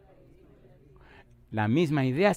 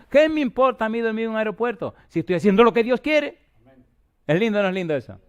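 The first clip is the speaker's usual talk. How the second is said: loud, high fervent preaching, slowing on the significa lines; full-force preaching; 7 semitones higher, 5 dB louder than usual. Acted. Preacher to his congregation about joy in sacrifice, booming and fervent. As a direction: proclaim it like a revival preacher.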